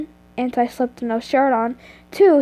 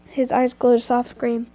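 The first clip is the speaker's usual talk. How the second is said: usual speaking voice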